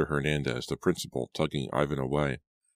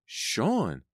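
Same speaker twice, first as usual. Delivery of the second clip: high, stunned cry; shocked gasp of a name; 8 semitones higher than usual. A narrator's reading. Shocked recognition of Sean.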